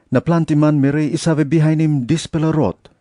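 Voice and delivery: usual speaking voice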